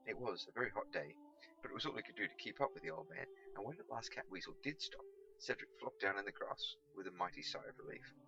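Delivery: plain reading voice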